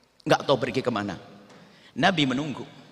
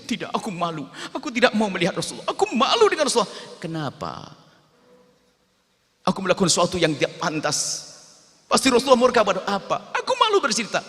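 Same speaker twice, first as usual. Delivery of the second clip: rising into high, loud tearful pleas; ashamed boy crying to Umar, building to a wail; ashamed weeping; 7 semitones higher, 4 dB louder than usual. Acted. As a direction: refuse through tears, more and more desperate. Boy refuses, weeping with shame.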